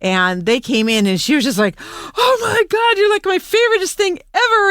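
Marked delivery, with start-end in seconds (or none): 1.8-4.7 excited dog voice. Retold or imitated. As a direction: squeal it out like an overjoyed dog meeting old friends. Owner voicing excited dog greeting friends.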